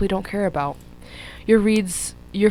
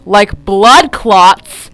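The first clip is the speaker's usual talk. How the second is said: louder, slow disbelieving repeat; incredulous; 12 dB louder, much slower than usual. Real, not acted. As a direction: react in amused disbelief. Exclaims at the simile.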